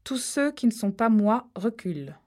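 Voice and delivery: firm order to back off; commanding. Acted. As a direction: take charge of the crowd. Rachel takes command. (Rachel ordonne à tous de reculer, autoritaire)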